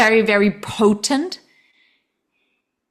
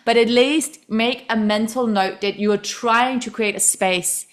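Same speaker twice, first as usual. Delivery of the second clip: ordinary delivery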